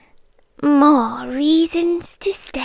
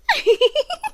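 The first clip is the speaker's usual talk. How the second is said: a light, airy giggle at the captive's fear; amused little queen's laugh; teasing laugh; 13 semitones higher than usual. Acted. Teasing her frightened captive.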